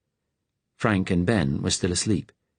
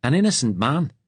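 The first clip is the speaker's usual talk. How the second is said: put-on Liverpudlian accent; 7 semitones higher, 3 dB louder than usual. A narrator's reading. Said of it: "The Liverpudlian accent".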